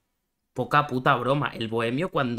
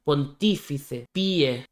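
slow, careful Pontífice, stress on tí; slow sounding out; much slower than usual. Shown as a demonstration. Checking the rhyme aloud, slowly. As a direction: draw out Pontífice to hear its ending.

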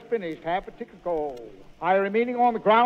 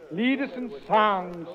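ordinary talk, nothing marked

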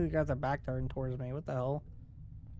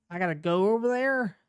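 scared horror game streamer; 6 semitones higher, 6 dB louder than usual. Real, not acted. Dreading going in horror game.

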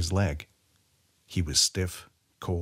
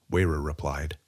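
ordinary delivery

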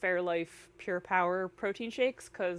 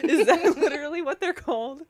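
amused surprise; 7 semitones higher, 8 dB louder than usual. Real, not acted.

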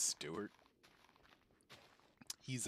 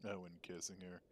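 usual speaking voice

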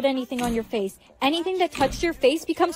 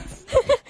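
short high-pitched giggle; amused laugh; 9 semitones higher than usual. Acted. Friend laughing off Brianna's odd claim, amused giggle. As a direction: burst into a brief high laugh.

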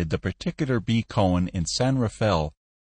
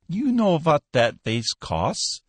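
slow, raised, sugary question; syrupy; 7 semitones higher, slower than usual. A narrator's reading. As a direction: ooze sweetness at the eager customer. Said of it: "voice dripped with syrup".

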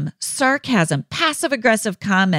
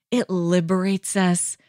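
normal talking voice

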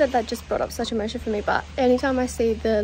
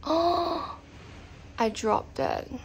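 dismayed; 5 semitones higher, 5 dB quieter, much slower than usual. Real, not acted. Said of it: "I could actually cry".

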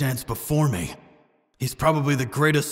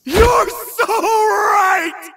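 euphoric cackling yell, way up high and drawn out; manic laughing shout; 6 dB louder, much slower than usual. Acted. Revived sorcerer gloating at his killer, manic laughing shout. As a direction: laugh and scream it like sudden madness.

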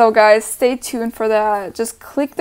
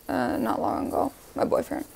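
quiet, unvoiced aside; whispered aside; 3 semitones higher, 8 dB quieter than usual. Real, not acted. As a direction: drop to a hushed whisper. Aside, voice dropped.

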